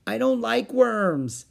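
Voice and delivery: put-on high, slow, loud childish refusal; whiny small boy. A narrator's reading. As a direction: drag it out like a disgusted kid.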